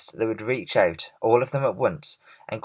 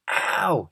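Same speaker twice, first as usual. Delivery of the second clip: higher yelp of pain; pained cry; 8 semitones higher than usual. A narrator's reading. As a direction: yelp as the hail hits. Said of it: "he cried".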